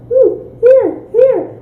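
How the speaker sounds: put-on high little-kid voice offering toys; squeaky toddler offering; child's voice. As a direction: mimic a four-year-old handing things over. Four-year-old offering baby toys.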